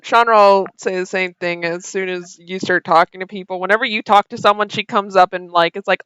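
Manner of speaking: ordinary talk, nothing marked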